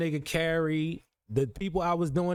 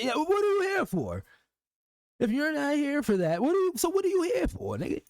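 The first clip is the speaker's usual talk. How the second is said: much higher, pressing question; incredulous challenge; 10 semitones higher than usual. Real, not acted. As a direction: demand an answer, voice climbing. Challenging the viewer, rising.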